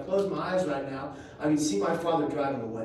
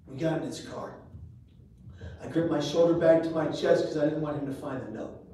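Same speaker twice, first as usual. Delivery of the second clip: ordinary talk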